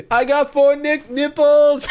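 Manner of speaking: high, loud mock-excited yell; imitating excited shout. Host mimicking an excited dating-profile recording, high excited yell. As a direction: squeal it out in over-the-top excitement. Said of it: "he was really excited".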